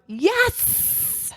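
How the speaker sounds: high-pitched, held-back cheer of yes; a small, squealed victory cry; mock celebratory shout. Shown as a demonstration. Waking up and greeting the day, restrained mock shout. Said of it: "and I shout".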